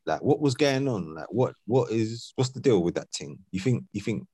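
ordinary delivery

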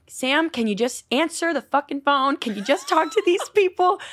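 slow, high-pitched pleading, re-enacted; exasperated manager. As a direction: imitate a manager begging in exasperation, climbing in pitch. Fed-up manager acted out.

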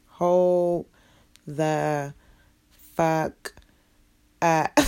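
one word at a time; long pause between each word; halting disbelief. Real, not acted. Reacting to being called girlfriend, word by stunned word.

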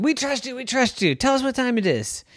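lightly voiced pleading crowd. Crowd begs Min.

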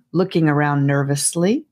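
ordinary delivery

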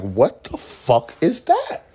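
high, slow, stunned at the smell; high drawn-out what-the-heck; shocked disgust. Retold or imitated. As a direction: recoil from the stink, drawn out and high. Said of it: "I was like".

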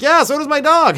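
put-on high, loud cocky teen retort; cheeky comeback. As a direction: shout back a smart-aleck reply. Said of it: "I'm like".

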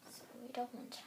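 quick whispered aside; hushed aside. Real, not acted.

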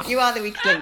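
laughing mock game-show put-down. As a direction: toss it out while laughing.

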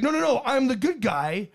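high, hasty protest; acted frantic denial; mock pleading. Cornered good guy pleading.

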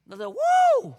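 high loud whoop; shout of joy. Acted. Preacher showing the shout of joy, loud whoop. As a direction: cut loose with a joyful woo.

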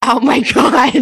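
loud, high Oh my God; shocked delight. Real, not acted. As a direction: gasp it out in scandalised delight. Reacting to the punchline.

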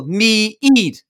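"me eat" drawn out as two separate beats, MEE-EAT; split into two syllables. Retold or imitated. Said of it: "You tried to make two syllables out of one".

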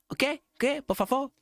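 high, puzzled mock shopkeeper asking again; confused shopkeeper. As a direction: play the baffled foreign cook who didn't catch it. Foreign shopkeeper not understanding.

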